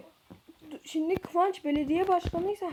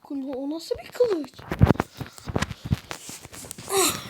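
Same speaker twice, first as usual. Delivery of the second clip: normal talking voice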